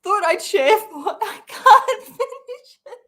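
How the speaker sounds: high, loud, breaking up with laughter; helpless laughter. Real, not acted. Reader unable to finish a post, helplessly laughing. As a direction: try to read, then crack up. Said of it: "I needed that laugh".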